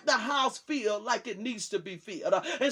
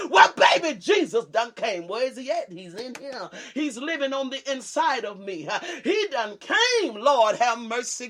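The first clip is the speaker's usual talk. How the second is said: ordinary talk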